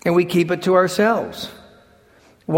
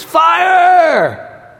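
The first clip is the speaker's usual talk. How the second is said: high, loud shout of alarm; yelled warning; 12 semitones higher, 7 dB louder than usual. Retold or imitated. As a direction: scream it to wake the sleepy crowd. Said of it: "he yelled at the top of his voice".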